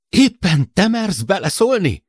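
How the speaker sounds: high, loud, indignant outburst; incensed challenge at high pitch; furious retort. A narrator's reading.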